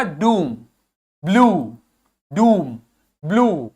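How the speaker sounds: long "oo" in डूम and ब्लू; long OO sound. Shown as a demonstration. Teacher shows double O with cap, long "oo" in डूम, ब्लू. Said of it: "ये sound हो जाता है".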